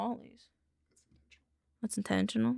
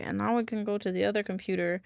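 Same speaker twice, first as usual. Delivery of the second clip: ordinary talk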